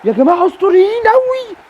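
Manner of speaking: excited shout. Real, not acted. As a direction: celebrate like a goal just went in. Celebrating the hat-trick. (صياح فرح عالٍ)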